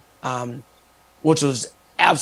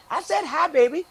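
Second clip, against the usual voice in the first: high, sweet diva voice, much higher; 11 semitones higher than usual. Retold or imitated. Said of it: "She goes".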